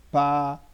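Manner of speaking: pa alone, loud and held; syllable demonstration. Shown as a demonstration. Teacher testing which syllable is stronger, PA loud and isolated. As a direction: hold out a loud PA and leave it hanging. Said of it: "de forma mais intensa".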